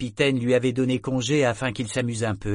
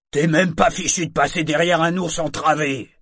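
loud, high mockery; scornful yell; 6 semitones higher, 7 dB louder than usual. A narrator's reading. Torg raille Confrette en criant, moqueur. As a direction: jeer at him across the room. Torg keeps yelling insults.

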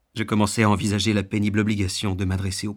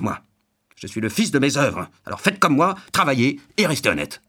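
indignant, moralizing tirade that builds in force; self-righteous gruff householder. Acted. Self-made man scolding a beggar as lazy, indignantly. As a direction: lecture him with proud, mounting emphasis.

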